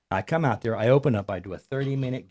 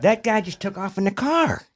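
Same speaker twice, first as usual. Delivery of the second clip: higher-pitched news from someone running in; retold alarmed report; 8 semitones higher, 3 dB louder than usual. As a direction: burst in with startling news, voice raised in pitch. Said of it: "Someone come running inside".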